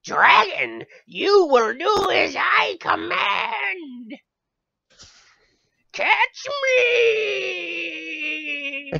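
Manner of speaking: high, loud, drawn-out villain shout; hammed-up imitation of horrendous voice acting; bad game voice acting. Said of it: "horrendously bad voice acting they had".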